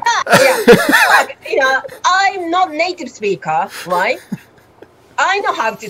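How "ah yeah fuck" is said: high, loud giggling swear; laughing swear. Real, not acted. Guest joins in swearing with the host, laughing. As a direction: let the swear burst out through a laugh.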